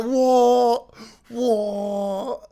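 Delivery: high, loud, drawn-out mock amazement; pretend excitement. Child opening an electric guitar, hammed-up pretend excitement. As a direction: overdo the thrilled disbelief. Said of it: "kind of pretending to be excited".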